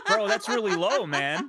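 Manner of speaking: loud laughing protest; mock outrage. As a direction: shout it in mock offense.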